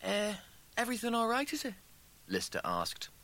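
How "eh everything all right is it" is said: lightly voiced hesitant friend, higher pitch; tentative enquiry. A narrator's reading. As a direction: ask it gingerly after a row. Lister asks awkwardly after the row.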